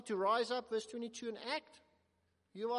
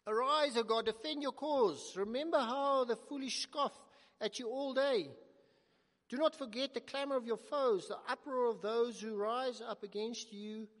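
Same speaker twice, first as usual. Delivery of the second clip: raised, ringing voice reading the psalm; proclaiming scripture; 2 semitones higher, 5 dB louder than usual. Acted. Preacher reading the psalm's plea to God, loud and ringing.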